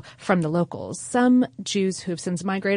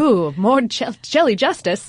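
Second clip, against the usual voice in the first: high and loud pun; excited; 6 semitones higher, 8 dB louder than usual. Real, not acted. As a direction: cheer out the pun. Punning excitedly.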